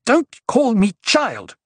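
high, indignant outburst, words drawn out; outraged rebuke; angry snap. A narrator's reading. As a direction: cut in furiously, pitch leaping up. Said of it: "she snapped".

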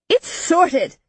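high-pitched, exasperated shout; insistent shout. A narrator's reading. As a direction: shout to settle the matter. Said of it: "Jaren shouted".

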